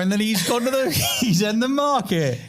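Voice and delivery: high Cockney geezer, half shouting; hammed-up East End banter; Cockney accent. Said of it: "Give us your best Cockney accent".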